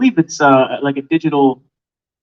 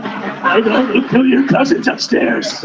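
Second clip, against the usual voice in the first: high, slow mock-menacing devil; 7 semitones higher, slower than usual. Shown as a demonstration. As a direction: draw out a hammed-up demonic threat. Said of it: "try to speak like the devil".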